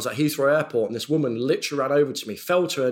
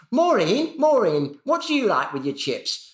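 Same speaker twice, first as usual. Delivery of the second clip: Derek calling Maureen; 7 semitones higher, slower than usual. Acted. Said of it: "So I could almost be like".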